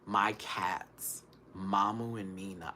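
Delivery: normal talking voice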